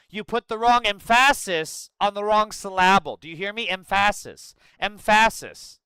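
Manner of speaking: wrongly stressed "emphasis", loud em-FASS-iss; high, pompous mock correction leaning hard on em-FASS-iss; Mike Myers bit. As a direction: punch the wrong syllable in "emphasis" and "syllable". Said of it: "He's doing that Mike Myers bit".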